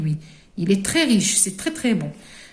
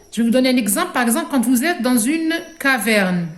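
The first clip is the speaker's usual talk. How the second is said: usual speaking voice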